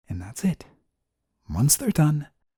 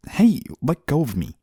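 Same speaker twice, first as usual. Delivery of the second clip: higher, louder startled objection; startled protest; 6 semitones higher, 5 dB louder than usual. Acted. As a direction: protest as if suddenly grabbed. Guardian grabbed while leaving.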